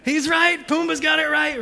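mock excited shout of agreement. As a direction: cheer it like a crowd agreeing. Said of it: "And we're like".

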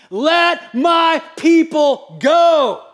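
loud, high, drawn-out shout; booming yell. Acted. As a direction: bellow it as a command, word by word. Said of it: "He yelled to Satan".

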